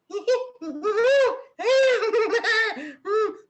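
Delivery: high, loud, zany cartoon giggle; cartoon laugh. Shown as a demonstration. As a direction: laugh like a cartoon in hoots and squeals. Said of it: "आप अगर कोई cartoon play कर रहे हो". (Cartoon जैसी ऊंची हंसी)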